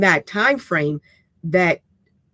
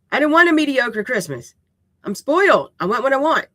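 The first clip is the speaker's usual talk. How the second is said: spoiled brat; 7 semitones higher, 4 dB louder than usual. Pretend. Admitting her spoiled Christmas flaw.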